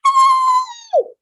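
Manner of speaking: piercingly high, loud squeal; as high as possible. Shown as a demonstration. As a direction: squeal hello at the top of your range. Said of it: "as high as we can".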